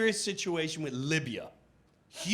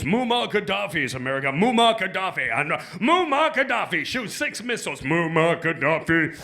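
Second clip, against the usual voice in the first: news announcer; 5 dB louder than usual. Retold or imitated.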